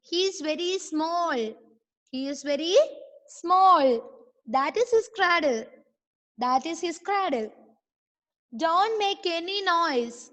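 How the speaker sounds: slow, drawn-out read-aloud for learners; model reading. Shown as a demonstration. Teacher reading the story to online pupils, slow model reading. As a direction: read each word slowly with long stretched vowels.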